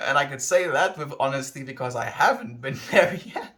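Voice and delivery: amused outburst breaking into laughs; laughing. Real, not acted. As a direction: laugh your way through the words. Laughing at own evasive answer.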